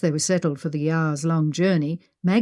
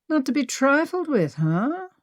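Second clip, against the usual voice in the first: mocking echo of her line, pitched much higher; Henry teasing Maggie by repeating her threat; 8 semitones higher than usual. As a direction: throw her words back at her, amused.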